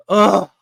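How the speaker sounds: loud, fed-up groan; a loud groan of exasperation; exasperated groan. Real, not acted. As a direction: let out a groan of pure frustration. Fed up with his team.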